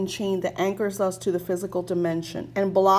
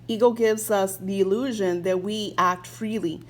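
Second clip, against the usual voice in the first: usual speaking voice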